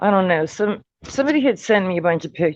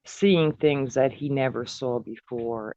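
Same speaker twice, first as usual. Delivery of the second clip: normal talking voice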